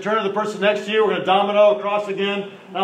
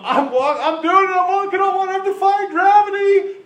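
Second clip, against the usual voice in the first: much higher, thrilled boasting; giddy excitement; 14 semitones higher, 4 dB louder than usual. Retold or imitated. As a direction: play Peter thrilled he's walking on water. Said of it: "Peter went from".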